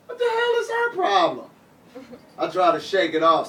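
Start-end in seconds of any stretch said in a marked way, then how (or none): 0.1-1.5 high, squeaky disbelief; incredulous. Retold or imitated. Performer reacting to his disapproving dead aunt, high incredulous squeak. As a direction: act baffled and put out. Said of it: "I'm like".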